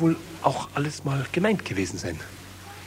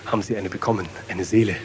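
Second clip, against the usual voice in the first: usual speaking voice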